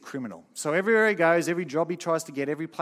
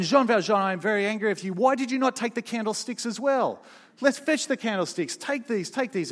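higher, louder, mock-stern bishop; bishop's mock anger; 8 semitones higher, 5 dB louder than usual. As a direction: scold him gently, then press the gift on him. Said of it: "the bishop, almost without blinking, says".

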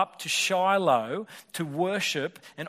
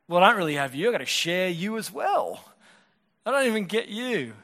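a hint of a higher, hurt wife's voice; wife answering her husband, lightly voiced hurt; 4 semitones higher, 4 dB louder than usual. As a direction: lightly voice a wounded wife, rising. Voicing Hannah's hurt reply.

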